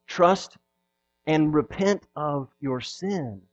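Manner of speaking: slow, quiet, earnest plea; soft appeal. Acted. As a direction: gently urge them to repent. Soft pleading exhortation.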